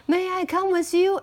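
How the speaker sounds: a turkey politely asking to tag along. Acted. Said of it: "asked Turkey Lucky".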